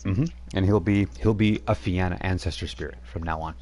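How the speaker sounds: ordinary talk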